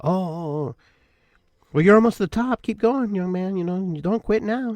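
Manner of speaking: nun checking on a struggling hiker, high and concerned; kindly nun. Retold or imitated. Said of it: "the one nun goes to me".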